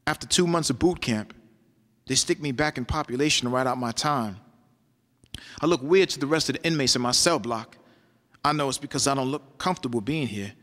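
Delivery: measured rhythmic poetry delivery; spoken word poem. Acted. Poet recounting taking the year in prison, spoken word. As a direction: perform it like a slam poem, riding the rhythm.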